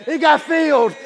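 loud, high, driving sermon cadence; full-force preaching. Acted. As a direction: preach it with fire.

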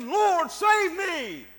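high, loud, drawn-out cry; preacher acting out a drowning man's plea; desperate shouted plea. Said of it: "and he just said".

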